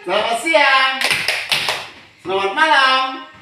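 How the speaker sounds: high, loud classroom cues; called greetings. Shown as a demonstration. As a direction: call each greeting out to the class. (salam diserukan keras kepada kelas)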